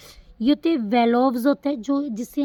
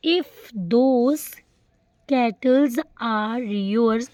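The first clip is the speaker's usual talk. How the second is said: each word spaced out; very slow example reading; slow dictation; much slower than usual. Shown as a demonstration. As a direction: read slowly so students can copy. Teacher reads example sentence aloud.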